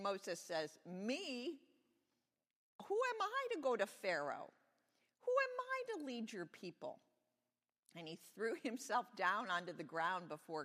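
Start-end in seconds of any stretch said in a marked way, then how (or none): 0.9-7.0 very high-pitched, disbelieving squeal; incredulous protest. A narrator's reading. Moses doubts God's choice of him, high incredulous protest. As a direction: protest it high, falling away at the end.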